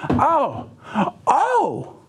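high, sudden "Oh, oh" of realization; a cry of sudden recognition; "Oh, oh" leaping high as it dawns on him; startled realization. Retold or imitated. Realizing friend calls from Baghdad.

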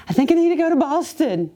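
high-pitched, lightly mock-tearful blurt; tearful plea. Wife waking her husband at night, mock-tearful. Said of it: "one night crying".

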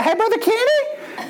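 put-on high, light girlish call; girl's voice. Katie greeting the preacher, high and girlish. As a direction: pipe up like a young girl. Voicing a young girl.

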